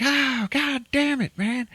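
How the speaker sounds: high, slow squealed curse; pained frustration. Retold or imitated. Villain shot in the hand again, high pained whine. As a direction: whine it out through the sting.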